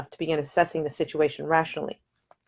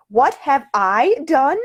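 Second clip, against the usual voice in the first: higher, louder, slower, indignant; rising outrage; 10 semitones higher, 7 dB louder, much slower than usual. Acted. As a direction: throw the question back, offended. She is accused, goes rigid.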